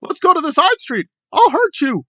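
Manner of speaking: high, hammed-up menace. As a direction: parody his threat in a high voice. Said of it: "threatened D O A with physical violence".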